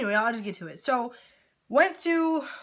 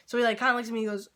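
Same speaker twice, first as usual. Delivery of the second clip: usual speaking voice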